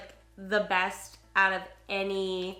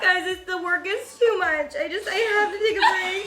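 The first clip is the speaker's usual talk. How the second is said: high laughing mock whine; mock whining; 7 semitones higher, 4 dB louder than usual. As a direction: parody her old break video. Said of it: "your first video".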